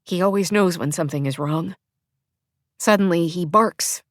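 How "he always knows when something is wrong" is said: ordinary delivery, nothing marked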